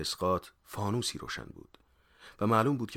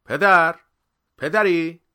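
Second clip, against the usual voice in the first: high, loud call to father; shouted hail, pitched way up; 11 semitones higher, 16 dB louder, much slower than usual. A narrator's reading. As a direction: call out loudly for someone inside. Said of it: "با صدای بلند گفت".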